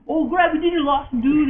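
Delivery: high, loud imitation of a frantic game hero; excited mock exclamation. Player acting out the game heroes' banter for friends, excited mock alarm.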